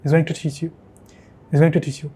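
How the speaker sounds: GOING TO run together, TO as "tuh"; native connected form. Shown as a demonstration. Teacher repeating the native way, TO reduced to "tuh". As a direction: reduce TO and link the words. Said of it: "कुछ इस तरह से बोलेंगे".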